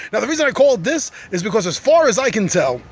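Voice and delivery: normal talking voice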